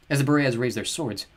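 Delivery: plain reading voice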